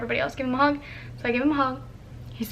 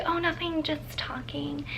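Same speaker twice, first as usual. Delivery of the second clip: soft, slow, near-whispered cover-up; feigned innocence; 4 semitones higher, 9 dB quieter, slower than usual. Retold or imitated. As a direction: trail into a sheepish whisper. Feigned innocence when asked.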